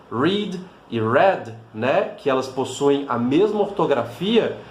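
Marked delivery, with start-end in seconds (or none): 0.1-1.5 "read" dito bem devagar, duas vezes; duas pronúncias. Shown as a demonstration. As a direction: fale "read e read" lentamente. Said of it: "por exemplo, essas duas". ("read" e "read" pausados, contrastando os sons)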